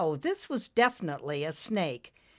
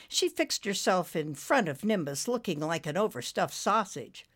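usual speaking voice

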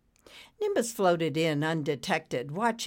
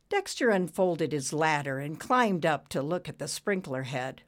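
plain reading voice

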